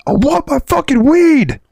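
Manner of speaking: high, drawn-out mock bellow; imitated yelling. Neighbor yelling at the shut door, imitated yelling. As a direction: wail the demand up high and slow. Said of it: "he starts yelling and we hear".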